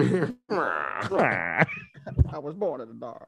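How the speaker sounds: slow, hushed imitation of Bane. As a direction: murmur it like a villain. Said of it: "fucking Bane and shit".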